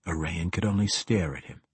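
usual speaking voice

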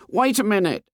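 put-on high, calling boy's voice. A narrator's reading. A boy calls to a stranger through the jungle, high and eager. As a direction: shout for attention like a small boy. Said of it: "it said".

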